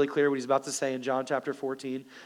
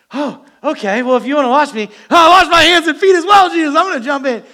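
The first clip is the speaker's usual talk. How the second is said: much higher, loud, gushing disciple; eager Peter shouting to Jesus; overeager Peter; 10 semitones higher, 13 dB louder than usual. Acted. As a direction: throw yourself at it, high and loud.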